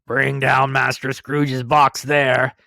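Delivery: loud, slow bellow down the hall; terrible voice. Acted. Schoolmaster calls from the hall, slow loud bellow. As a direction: boom it out like a stern schoolmaster. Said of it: "A terrible voice in the hall cried".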